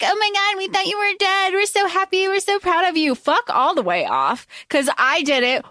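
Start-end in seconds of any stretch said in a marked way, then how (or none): 0.0-3.1 high, sugary fake-proud parent voice; mock gushing parents. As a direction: mimic the phony relief, pitched up. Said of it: "And you were like".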